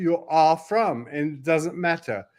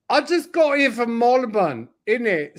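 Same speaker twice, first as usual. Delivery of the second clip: playful put-on Cockney, a little higher; mock Cockney accent; 6 semitones higher than usual. Mock Cockney to friends, playful London accent. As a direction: lay on a cheeky London accent. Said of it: "I'm switching to Cockney".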